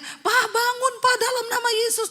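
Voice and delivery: very high urgent wife voice; wife crying out. Retold or imitated. Wife calls to collapsed husband, high urgent cry. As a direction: call out high to rouse him. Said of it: "Istrinya teriak".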